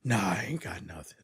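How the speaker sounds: whispered deep imitation of the DJ voice; mocking Quiet Storm. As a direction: breathe it out like a late-night DJ. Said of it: "coming in like Quiet Storm".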